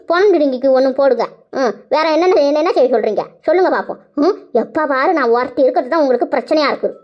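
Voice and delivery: normal talking voice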